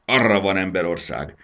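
put-on a fuming dragon mother shooing off a human child; grumbling rage. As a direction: drive the girl away in anger. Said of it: "bökött dohogva".

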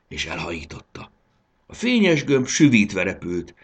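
usual speaking voice

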